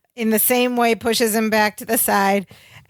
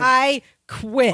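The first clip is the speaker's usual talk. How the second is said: dramatic retold resignation; emboldened lecture; 3 semitones higher than usual. Larry quitting on his boss, mock-dramatic declaration. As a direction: imitate a bold announcement, held out for effect. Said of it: "gets this courage to lecture".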